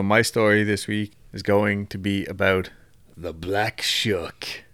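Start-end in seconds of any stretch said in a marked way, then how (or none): 3.2-4.6 spooky whisper. Acted. Dramatic reveal of story subject.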